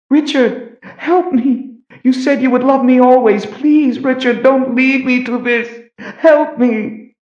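very high, drawn-out desperate cry; screamed plea. A narrator's reading. Kaelin begging Richard from among snakes, screaming for help. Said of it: "Richard heard a scream".

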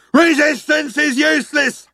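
high, full-throated guard's shout; shouted Vogon command; bellow. A narrator's reading. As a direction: bellow it like an official slogan. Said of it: "bellowed the guard".

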